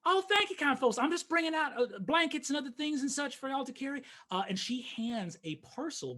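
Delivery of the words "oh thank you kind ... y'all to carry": high, bright country wife voice; eager farm wife thanking guests; farmer's wife. Acted. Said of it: "his wife, runs out and says".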